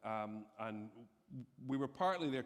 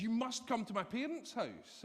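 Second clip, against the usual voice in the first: higher, eager invitation; eager insistence; 9 semitones higher than usual. Retold or imitated. Old friend insisting the couple come to dinner, higher eager voice. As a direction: press the invite on them gladly.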